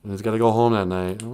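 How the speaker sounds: ordinary delivery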